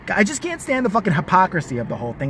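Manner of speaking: ordinary delivery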